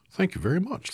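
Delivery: lightly voiced Scarecrow. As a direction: squeak out happy thanks.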